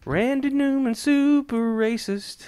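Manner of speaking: mock announcer.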